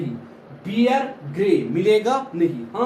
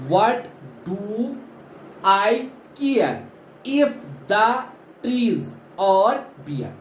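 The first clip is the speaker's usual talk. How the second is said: "what do / I care / if the / trees are bare" in separated beats; slow, chunked verse with pauses after "do", "care" and "the"; stressed-unstressed rhythm; 2 semitones higher, much slower than usual. Shown as a demonstration. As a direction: beat out "what do I care" for a class. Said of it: "combination of stressed and unstressed syllable".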